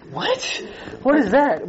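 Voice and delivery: high startled gasp of surprise; shocked squeak then a puzzled question; startled what. Pretend. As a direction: jump at the sight, pitch shooting up. Imagined reaction to burning bush.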